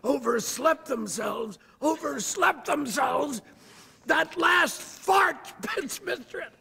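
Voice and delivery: put-on shouted high British accent, full authority. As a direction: bellow the rhyme with total authority. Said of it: "only louder and with more authority".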